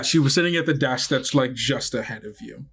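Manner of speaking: ordinary talk, nothing marked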